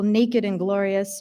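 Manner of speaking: usual speaking voice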